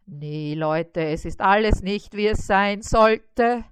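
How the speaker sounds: plain reading voice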